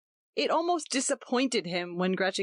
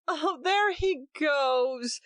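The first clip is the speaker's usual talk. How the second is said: delighted cry; 8 semitones higher, much slower than usual. A narrator's reading. Nursery chatter upstairs.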